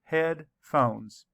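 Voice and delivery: Headphones said in two beats, head and phones; syllables split. Shown as a demonstration. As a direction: tap out the two parts of Headphones. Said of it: "Let's count the syllables".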